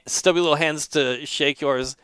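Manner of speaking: ordinary delivery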